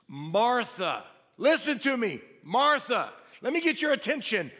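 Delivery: grab her attention with every Martha; emphatic repetition. Retold or imitated. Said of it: "He's saying, emphasizing".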